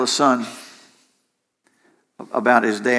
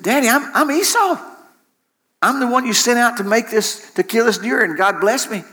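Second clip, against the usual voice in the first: higher-pitched pleading son, acted out; insistent son; 8 semitones higher than usual. Esau tells his blind father who he is, insistent. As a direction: plead your identity to a doubting father.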